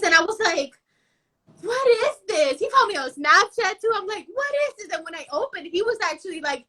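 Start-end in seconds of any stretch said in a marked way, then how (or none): 1.6-2.6 higher, puzzled what is this; mock disbelief, pitched up; baffled. Reacting to a begging stranger. 4.3-5.0 much higher, incredulous what is this; mock disbelief, pitched way up; more baffled. Reacting again to the stranger.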